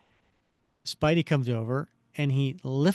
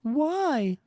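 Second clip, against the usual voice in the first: high woman's voice; 12 semitones higher than usual. Acted. Betty cries why.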